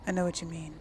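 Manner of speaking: normal talking voice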